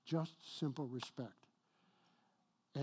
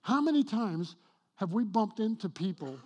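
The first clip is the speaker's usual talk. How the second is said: loud, higher preaching push; 5 semitones higher, 7 dB louder than usual. Acted. Pastor challenging congregation, loud preaching. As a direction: press the question on the congregation.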